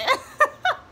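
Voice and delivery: loud high laugh; real delighted laugh; high burst of laughter. Real, not acted. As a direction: let a big laugh break out. Laugh after teasing.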